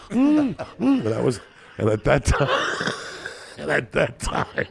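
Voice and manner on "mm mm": high-pitched mock muffled moans. Woman caught off guard, mouth full, high muffled mm. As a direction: squeak out a muffled mm. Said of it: "She's like".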